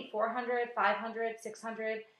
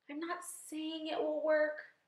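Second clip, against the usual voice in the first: slow, high, tentative voice; high and slow, hedging; slow, hesitant; 8 semitones higher, slower than usual. A narrator's reading. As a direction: draw it out cautiously, pitched up. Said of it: "James Henry Trotter said slowly".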